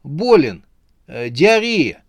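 high, hesitant excuse; weak, faltering voice. A narrator's reading. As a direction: grope nervously for an excuse. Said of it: "слабым голосом сказал он". (слабым голосом, запинаясь)

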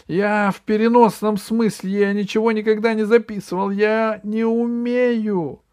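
high, loud frantic denial; panicked backtracking. A narrator's reading. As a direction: protest desperately, stretching the last words. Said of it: "спохватился Вэри Мэри". (панические высокие оправдания)

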